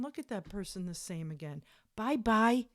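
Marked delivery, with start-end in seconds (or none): 1.9-2.7 loud, higher, mocking bye-bye; dismissive send-off. Real, not acted. Loudly telling the cheater to leave. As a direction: shoo him out the door.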